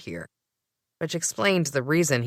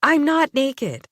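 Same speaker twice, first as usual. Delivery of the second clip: high, loud, slow protest; outraged denial; indignant; 10 semitones higher, 6 dB louder, slower than usual. Acted. Narrator snapping back at Gray, indignant.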